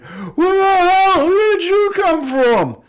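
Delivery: high, very loud drawn-out roar; giant's roar. Acted. Giant roars at the attacker who stabbed him, startled roar. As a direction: roar it slowly at full volume, pitch way up. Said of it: "He roars out about".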